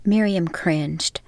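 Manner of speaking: plain reading voice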